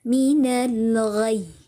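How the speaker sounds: "Minal ghai" drawn out very slowly, pressed on "ghai"; nabar demo. Shown as a demonstration. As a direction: recite "Minal ghai" slowly, leaning on the final "ghai" without breaking it. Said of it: "Itu yang dimaksud dengan nabar". ("Minal ghai" dengan nabar, pelan dan ditekan di "ghai")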